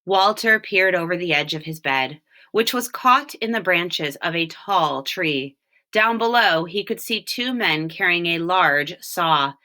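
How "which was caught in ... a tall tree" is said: plain reading voice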